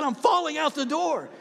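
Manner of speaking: high, loud cry of clinging on; acted fright. Skydiver clinging to the plane door, acted fright. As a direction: play the terror high and loud. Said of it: "I'm scared to death".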